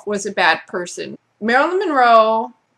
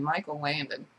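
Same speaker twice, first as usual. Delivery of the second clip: muttered aside; 5 semitones lower, 12 dB quieter than usual. Shown as a demonstration.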